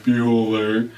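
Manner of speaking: drawn-out level monotone; Ben Stein. Acted. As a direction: drone the name like a roll call. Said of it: "do it in Ben Stein's voice".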